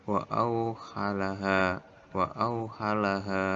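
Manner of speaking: "auhalaha" ending on a long held a; measured recitation, lingering on "auhalaha"; long final vowel. Shown as a demonstration. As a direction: recite slowly, stretching the last a of "auhalaha". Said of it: "maka dibaca panjang".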